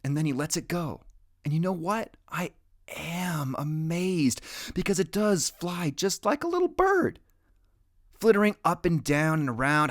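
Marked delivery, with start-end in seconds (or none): 2.3-6.7 hushed awe. A narrator's reading. Narrator marvels at flight.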